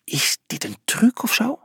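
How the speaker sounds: high, suspicious whisper; hushed, incredulous question; whispering back. A narrator's reading. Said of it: "fluisterde hij terug".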